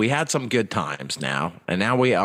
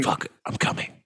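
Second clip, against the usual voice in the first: mock whisper moan; 3 semitones lower, 5 dB quieter than usual. Repeats prank moan bit.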